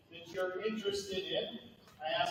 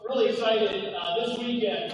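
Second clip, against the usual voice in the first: higher, projecting classroom voice; teacher voice; 3 semitones higher, 6 dB louder than usual. Shown as a demonstration. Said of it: "Use my teacher voice".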